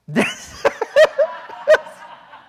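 high, helpless laughing fit; real laughter. Real, not acted. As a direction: crack up at your own impression. Cracks up at own mimicry.

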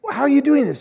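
loud, high incredulous question; amazed. Retold or imitated. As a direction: ask it in utter amazement. Retelling amazed question to grieving friend.

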